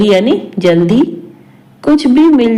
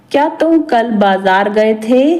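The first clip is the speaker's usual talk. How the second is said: ordinary talk, nothing marked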